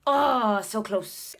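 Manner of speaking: ordinary delivery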